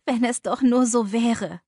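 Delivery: despairing, pained outburst; groaning lament. A narrator's reading. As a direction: cry it out in despair. Ich stöhne auf.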